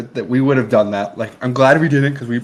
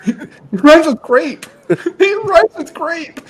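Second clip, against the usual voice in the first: slow, high, laughing through the line; can barely get it out for laughing; much higher, slow giggly delivery; 6 dB louder, slower than usual. Real, not acted. Laughing at the quoted joke.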